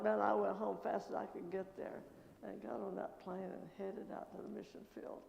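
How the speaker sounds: ordinary delivery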